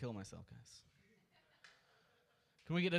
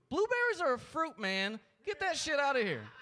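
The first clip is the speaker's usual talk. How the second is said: a comic yelling at TV doctors; ranting scorn; 5 semitones higher than usual. Real, not acted. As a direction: scoff hard at the idea.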